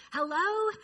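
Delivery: a mother picking up, eager; mom's cheery phone greeting; mom answering phone. Retold or imitated.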